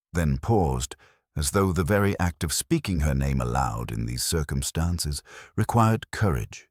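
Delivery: usual speaking voice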